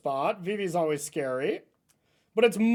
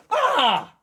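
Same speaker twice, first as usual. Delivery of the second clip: high, loud pretend fright; dad pretending his toddler scared him, mock scream; 7 semitones higher, 7 dB louder than usual. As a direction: fake being startled.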